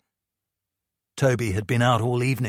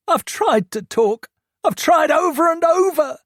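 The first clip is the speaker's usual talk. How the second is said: high, loud shouted outburst; exploding at mum; 6 dB louder than usual. A narrator's reading. Teen son explodes at his mum, high and shouting. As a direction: let it burst out, pitched up and shouting. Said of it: "he exploded".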